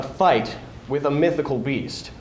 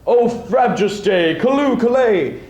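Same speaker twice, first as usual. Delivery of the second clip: louder, higher, delighted father; chortling in joy; 5 semitones higher, 3 dB louder than usual. Acted. Father greeting his returning son, joyful chortle. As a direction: burst with gleeful pride. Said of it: "He chortled in his joy".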